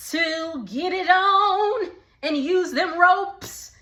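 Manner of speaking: high, slow giggling singsong; giggly teasing singsong; laughing singsong. Real, not acted. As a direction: sing it out through laughter. Giggly singsong about their night.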